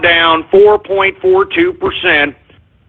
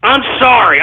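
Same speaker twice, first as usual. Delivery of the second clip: usual speaking voice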